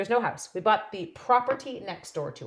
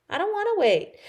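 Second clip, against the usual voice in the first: whiny; 13 semitones higher, 5 dB louder than usual. Real, not acted. Whining about waiting.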